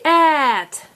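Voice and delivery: "at" with a long vowel; said slowly. Shown as a demonstration. Teacher modelling slow before fast, drawn out. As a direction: stretch "at" out slowly. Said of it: "Say it fast".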